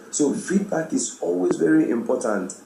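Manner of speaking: ordinary talk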